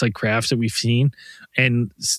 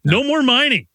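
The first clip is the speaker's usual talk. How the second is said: mock townsfolk; 11 semitones higher than usual. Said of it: "they're like".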